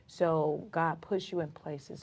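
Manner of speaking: usual speaking voice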